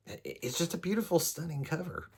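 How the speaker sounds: high, breathy-free swoon over the cover; rising up in delighted awe, then softening; gushing. Real, not acted. Booktuber admiring a favourite book cover, gushing and high.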